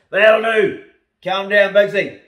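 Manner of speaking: real loud, high yell at dogs; shouted command. Real, not acted. Owner to dogs scrapping in the box, shouting. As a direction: call the dog off at full voice. Yelling at fighting dogs outside.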